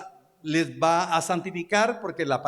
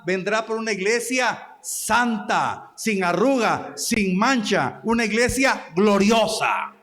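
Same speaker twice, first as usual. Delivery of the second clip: rising, slowing preacher's climax, louder toward the end; building preaching; 4 semitones higher, 3 dB louder than usual. Acted. Preacher telling the church the Christ is coming. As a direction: build the promise up to a ringing close.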